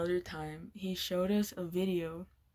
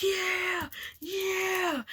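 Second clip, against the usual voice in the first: high whooping yeah; whooping cheer; 10 semitones higher than usual. Retold or imitated. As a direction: cheer like you just won. Winner cheering after the game.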